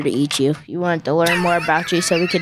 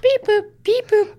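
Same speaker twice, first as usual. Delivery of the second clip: robot beeps. Pretend. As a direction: chirp it like a little robot. Pretending to be a robot alien.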